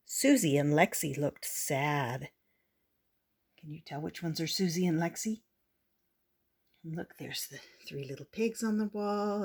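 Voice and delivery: ordinary delivery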